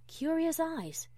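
ordinary delivery, nothing marked

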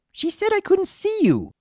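put-on very high, childish complaint; upset young filly. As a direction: complain like a hurt little girl. Filly protesting to friend.

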